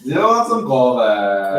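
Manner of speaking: put-on slow, drawn-out New York accent; exaggerated New Yorker offering coffee. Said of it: "my, uh, real New York accent".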